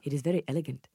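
soft attempt at a Nigerian accent. Shown as a demonstration. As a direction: try a Nigerian accent, softly. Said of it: "like, a Nigerian accent".

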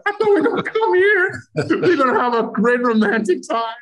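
mock whiny self. Husband whining to wife about their ruined romantic trip, mock high whine.

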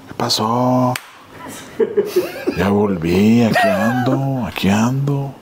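slow, drawn-out mock greeting; menacing stranger. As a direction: imitate an insistent stranger announcing himself. Imitating the stranger's repeated greeting.

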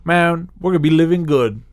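high, loud mock glee. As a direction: parody a naive voter gushing. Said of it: "what you're saying is".

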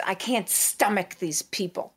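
lightly voiced disdainful old woman; a hint of a haughty matriarch; imitating grandmother. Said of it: "she talked just like Livia".

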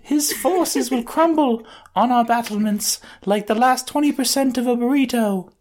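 put-on very high timid anime girl voice; shy anime girl voice. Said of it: "shy girls do in anime".

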